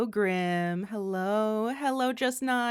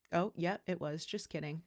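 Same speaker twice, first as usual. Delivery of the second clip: ordinary talk, nothing marked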